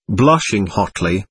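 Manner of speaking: ordinary delivery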